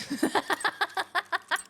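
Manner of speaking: high burst of laughter. Real, not acted. Laughs at goblin remark.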